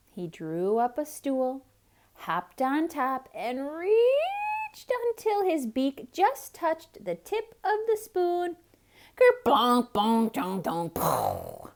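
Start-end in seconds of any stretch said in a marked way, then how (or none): 3.3-8.6 straining upward. A narrator's reading. Duck stretching up to reach. 9.2-10.6 loud clanging sound words; clattering noises. A narrator's reading. Spoon clattering down.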